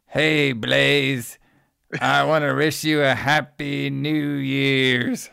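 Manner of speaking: slow, put-on creepy caller voice; mock a menacing prank caller. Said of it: "like, this, like, fucking voice".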